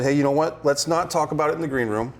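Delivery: ordinary talk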